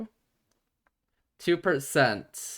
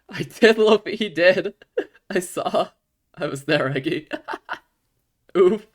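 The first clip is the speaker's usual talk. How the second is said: louder and higher, laughing through it; laughing hard; 6 semitones higher, 8 dB louder than usual. Real, not acted. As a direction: giggle all the way through. Laughing at friends' game.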